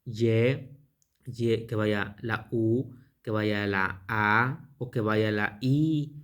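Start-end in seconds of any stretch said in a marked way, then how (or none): 0.0-0.8 YE as one held syllable; pronunciation demo. Shown as a demonstration. Teacher showing a possible reading of the name, sounded out. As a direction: sound out "ye" on its own. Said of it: "y la pronunciación gua".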